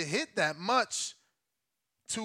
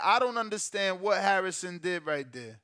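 ordinary talk, nothing marked

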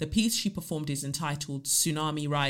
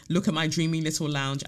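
ordinary delivery

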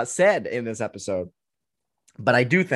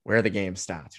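put-on Boston accent; 5 semitones lower, 4 dB quieter than usual. Host filling in for Connor on the slogan, Boston accent. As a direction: lower Boston accent, "starts" as "stahts". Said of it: "to do the Boston accent".

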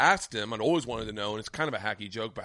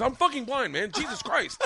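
mock angry outburst; 12 semitones higher than usual. Blind man snapping back.

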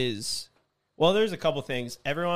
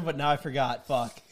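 ordinary talk

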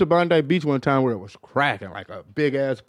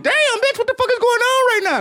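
high squeaky mock alarm; startled disbelief. Man reacting to bizarre moans in bed, comic alarm. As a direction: voice leaps way up in comic bewilderment.

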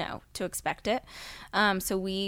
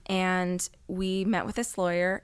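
ordinary delivery

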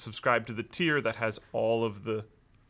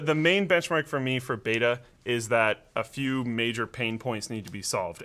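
ordinary talk

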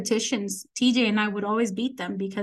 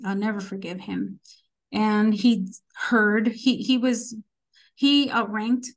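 normal talking voice

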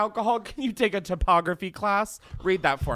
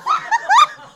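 shrieking laugh; 8 dB louder than usual. Real, not acted. Laughing after gagging on drink.

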